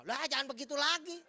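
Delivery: loud, high, exasperated cry of protest; exasperated telling-off; scolding outburst. Retold or imitated. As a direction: burst out scolding, pitch jumping up. Pastor acts scolding the wife.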